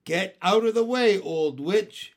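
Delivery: high, loud, brusque order; yelling. A narrator's reading. Boy to the witch in his way, yelling. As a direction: yell it to clear the path. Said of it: "yelled at Ramona".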